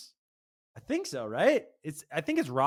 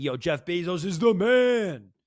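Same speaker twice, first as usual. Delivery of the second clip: slow, loud mock cheer for a billionaire; drawn-out mock fan shout; hyped fan shout; 4 dB louder, slower than usual. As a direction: belt it like a starstruck fan. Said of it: "then we would all be like".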